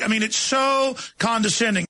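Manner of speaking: ordinary talk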